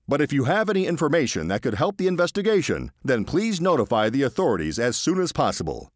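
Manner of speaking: brisk radio newsreader delivery; news read. Acted. Radio presenter reading the news to listeners, formal newsreader voice.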